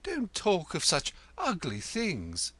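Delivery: 1 nervous. A narrator's reading. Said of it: "touching his throat nervously".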